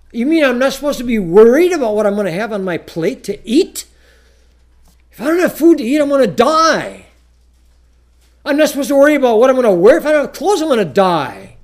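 hammed-up fretful complainer; worried objector. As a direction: play the panicked listener.

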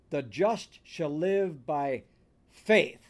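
slow down and land hard on "faith"; stress on faith. Shown as a demonstration. Preacher shows the church Romans puts weight on faith, stress on faith. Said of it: "the emphasis there is on the word faith".

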